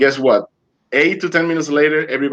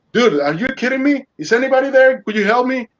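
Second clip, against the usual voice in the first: high, frantic cry of disbelief; a man adrift who can't believe the voice; desperate disbelief; 11 semitones higher than usual. Retold or imitated. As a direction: call out into the empty sea, half panicked. Calling out alone at sea.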